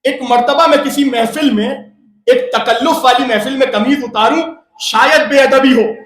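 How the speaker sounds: loud, impassioned sermon climax; full-force preaching. Acted. Preacher to the mourners on chest-beating, shouting. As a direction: preach it out with rising fervor, shouting.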